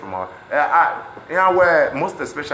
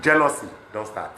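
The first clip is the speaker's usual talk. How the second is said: usual speaking voice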